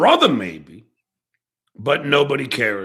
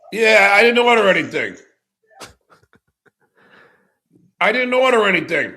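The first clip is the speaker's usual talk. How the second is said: high, raised voice, quick denial; calling out; 7 semitones higher, 4 dB louder, faster than usual. Real, not acted. Streamer denies a food delivery, calling out. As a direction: call it quickly across to the door. Answering the door from afar.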